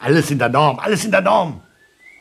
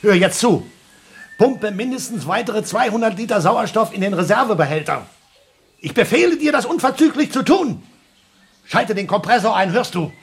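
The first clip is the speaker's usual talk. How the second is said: desperate order; 10 semitones higher than usual. Acted. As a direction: bark orders at the machine in panic. (verzweifelt laut befehlen)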